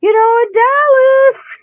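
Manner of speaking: slow, very high imitation of a man; drawn-out falsetto impression; high-pitched voice. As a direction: put on a high, strained voice. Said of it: "described that guy with a high-pitched voice".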